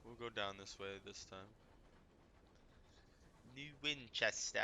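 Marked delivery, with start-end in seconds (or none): 3.6-4.6 New Winchester in a light mock London accent; a quick, half-hearted London accent on New Winchester; playful stab at a London accent. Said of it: "Gotta say it with a London accent".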